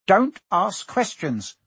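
irritated uncle shutting down questions; snapped. A narrator's reading. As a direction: snap it, stretching each word. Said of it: "snapped his uncle".

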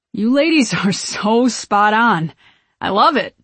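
giddy, loud admirer; tipsy gushing. A narrator's reading. Drunk fan gushes.

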